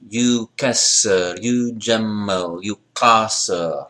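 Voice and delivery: slow You kasser, you jemmel, with long oo and held double consonant; clear measure-two vowels. Shown as a demonstration. Teacher drilling vowels, You kasser, you jemmel said slowly. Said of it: "listen for the vowels".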